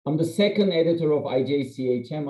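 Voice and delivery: normal talking voice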